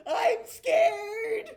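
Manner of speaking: very high, loud whimpering goblin cry; scared goblin. Acted. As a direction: wail it like a terrified little creature. Goblin falls and cries.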